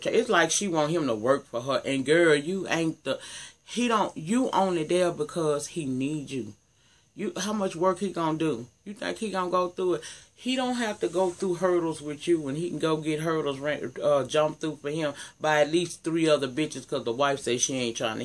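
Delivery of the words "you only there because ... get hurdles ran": normal talking voice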